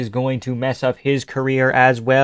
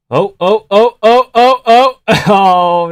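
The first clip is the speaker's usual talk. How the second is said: rising, much higher and louder oh's; excited yelling; 9 semitones higher, 7 dB louder, slower than usual. Real, not acted. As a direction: yell along as the fight turns wild. Reacting live to a fight.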